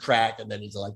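ordinary talk, nothing marked

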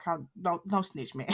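soft, low pleading; mock muted begging; hushed plea. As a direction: beg quietly not to snitch. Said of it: "He like".

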